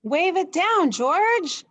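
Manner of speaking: excited dad calling to George; urgent call. Acted. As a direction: hurry him, call it out. Man urging George quickly.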